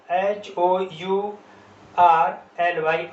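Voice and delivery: slow letter-by-letter spelling; teacher spelling it slowly; spelled out slowly. Shown as a demonstration. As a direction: spell each letter out deliberately. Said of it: "spelling letters slowly".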